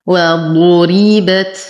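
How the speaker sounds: heavy ض, thin r, released final t in وضربت; heavy Dad. Shown as a demonstration. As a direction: chant وضربت slowly with heavy ض and full rounded u. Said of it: "نفخم الضاد مع إتمام الضم". (ترتيل وضربت مع تفخيم الضاد وترقيق الراء)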